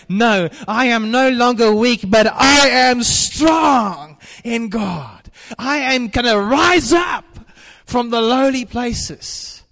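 high, loud preaching shout; defiant triumphant shout; shouted declaration. Acted. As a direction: cry it out in triumph, slowly.

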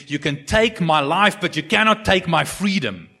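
rousing battle speech. Retold or imitated. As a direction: build it up slowly into a rallying cry. Wallace's rallying speech to troops.

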